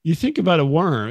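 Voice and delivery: normal talking voice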